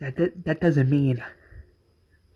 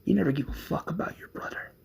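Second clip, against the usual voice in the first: whisper; 11 dB quieter, faster than usual. Acted. Accusing brother before pulling trigger.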